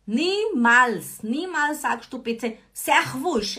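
loud Niemals, hammered; teacher forbidding a mistake, loud; stern warning. Real, not acted. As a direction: forbid it firmly. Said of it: "teacher stern warning".

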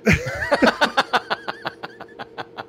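very high burst of real laughter. Real, not acted. Man laughing at his own stubbornness with flip-flops, very high burst. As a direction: crack up at yourself.

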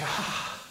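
breathless frightened gasp; sharp airless gasp of terror. Pretend. Thrown into the dark pit, frightened gasp. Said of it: "You say".

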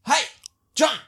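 short call to wake someone; calling a sleeper. Retold or imitated. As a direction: call his name to rouse him. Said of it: "I tried it both ways".

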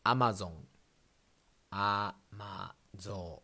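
"Amazon" as a-ma-zo-n, slowly; アマゾン; Japanese pronunciation. Shown as a demonstration. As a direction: say "Amazon" in flat Japanese syllables. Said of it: "Last one is Amazon".

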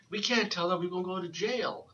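put-on high, urgent kid's warning; panicked girl voice. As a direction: blurt it like a panicked child. Young girl panics after fall.

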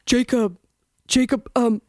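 hammed-up lovesick Bella stammering; Bella Swan impression. Host playing Bella pleading with Jacob, mock-tearful and laughing. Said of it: "[name]'s, uh, Bella Swan".

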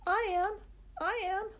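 put-on very high, excited kids shouting; a classroom calling out together; children shouting. A narrator's reading. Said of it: "they shouted".

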